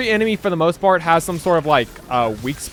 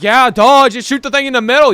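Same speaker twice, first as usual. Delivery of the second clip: mocking know-it-all; 6 semitones higher, 7 dB louder than usual. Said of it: "He's like".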